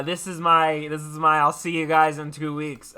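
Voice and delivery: ordinary talk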